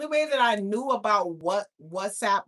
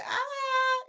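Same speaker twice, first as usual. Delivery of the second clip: excited squeal. Retold or imitated. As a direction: squeal it out, overjoyed. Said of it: "I was screaming that".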